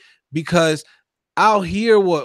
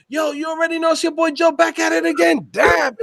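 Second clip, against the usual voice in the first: hyped casual persona; 10 semitones higher than usual. Acted. Showing how people think he greets interviewers, hyped casual persona. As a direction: burst in like your boy is back, pitched way up. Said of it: "I don't talk like that".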